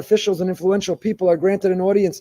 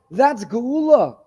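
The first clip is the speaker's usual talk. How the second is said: slow emphasis; 5 semitones higher, much slower than usual. Acted. Slowed emphatic declaration.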